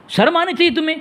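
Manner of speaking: sharp high-pitched rebuke; scolding. Real, not acted. Scolding the rival cleric on the call, sharp high rebuke.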